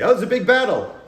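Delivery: loud, high-pitched stage exclamation; hammed-up announcement. Improviser offering a battle to the scene partner, hammed-up exclamation. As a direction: exclaim it like an excited stage warrior. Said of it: "for me to say".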